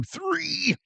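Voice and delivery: high squealed "three"; pained three. Retold or imitated. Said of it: "no, one, two".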